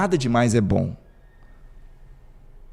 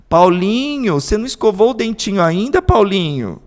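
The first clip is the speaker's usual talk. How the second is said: higher, louder sing-song mother; doting mother; 8 semitones higher, 5 dB louder than usual. Retold or imitated. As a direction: call him again sweetly. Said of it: "Aí vai passar cinco minutos". (mãe chamando de novo, mais aguda e doce)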